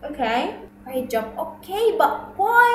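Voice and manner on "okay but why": lightly voiced puzzled student, higher; questioning student. Student challenges the teacher on why it matters, lightly acted questioning. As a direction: raise the pitch slightly theatrically, as a student asking. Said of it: "حد ذكي يعني يسأل السؤال العجيب ده".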